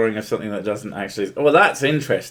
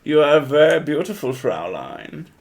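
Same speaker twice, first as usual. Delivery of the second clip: hammed-up flirty German accent, higher; flirty fake German accent to charm the Fraulein; 4 semitones higher than usual. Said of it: "I have multiple fake German accents".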